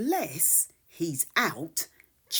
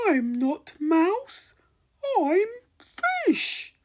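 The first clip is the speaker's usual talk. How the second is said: a lightly voiced fish; 11 semitones higher, 5 dB louder than usual. Fish speaks in story.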